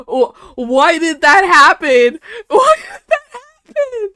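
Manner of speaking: high, loud, half crying why; shocked disbelief. Real, not acted. Streamer asks the screen, loud and shocked.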